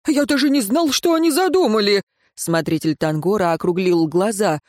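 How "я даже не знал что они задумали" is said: high-pitched, protesting innocence; character voice of an anxious father caught out; flustered excuses. A narrator's reading. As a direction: plead ignorance in a raised voice. Градосмотритель оправдывается, округлив глаза.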